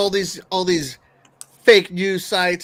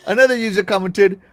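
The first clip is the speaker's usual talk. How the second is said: ordinary talk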